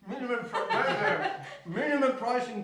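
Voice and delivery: laughing. Real, not acted. Reading news, breaks into laughter.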